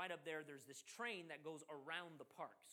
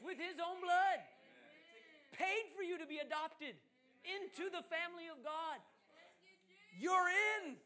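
much higher and louder, shouting the gospel; rising revival preacher's cry; full-force preaching; 10 semitones higher, 11 dB louder than usual. Acted. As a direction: preach it to the back row.

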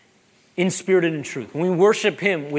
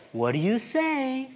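put-on higher, slow, softer prompt to a toddler; sing-song reminder to a small child; 7 semitones higher, 5 dB quieter, slower than usual. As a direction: coax a little one gently. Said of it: "from real little".

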